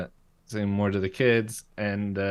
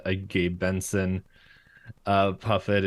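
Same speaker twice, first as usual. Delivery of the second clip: ordinary delivery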